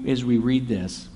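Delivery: ordinary delivery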